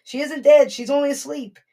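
Jesus calling over the wailing crowd; Jesus commanding. Retold or imitated. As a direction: silence the mourners with authority. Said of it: "He says".

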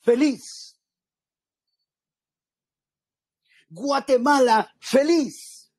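declaimed. Shown as a demonstration. Preacher showing the congregation how to declaim the anthem, grandly. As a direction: declaim "Guatemala... feliz" with grand pauses, rising each time. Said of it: "si usted lo quisiera declamar".

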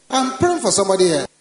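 loud, fast command prayer; preaching at full force. Acted. Preacher rebuking witchcraft over the congregation, shouted. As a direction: thunder the prayer over the crowd.

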